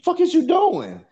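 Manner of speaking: frantic high-pitched yelling to get her out before the wife comes; angry yell. Retold or imitated. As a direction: chew her out, voice cracking up high.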